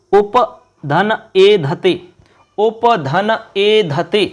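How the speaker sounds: उप धन ए धते read very slowly, word by word; slow dictation. Shown as a demonstration. Teacher dictating the question to students, very slow. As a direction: spell the split out slowly for the class. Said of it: "बताना है कि क्या रूप बनेगा".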